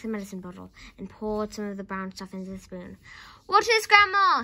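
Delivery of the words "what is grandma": high, loud call; shouted. A narrator's reading. George calls up to Grandma, shouting. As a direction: shout up to someone on the roof. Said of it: "he shouted".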